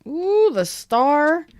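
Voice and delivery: high, slow delighted ooh; excited ooh. Real, not acted. Reader thrilled to draw the star card.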